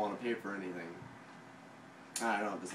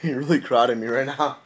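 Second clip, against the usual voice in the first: loud complaint; 15 dB louder than usual. Real, not acted. Friend crowded while eating.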